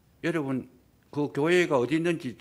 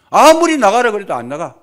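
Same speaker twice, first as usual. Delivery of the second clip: emphatic loud telling; 8 semitones higher, 17 dB louder than usual. Acted. Preacher stresses stubborn refusal.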